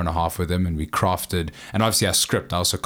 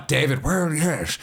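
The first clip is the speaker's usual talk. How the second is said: hammed-up impression; 8 semitones higher than usual. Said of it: "I've always been like".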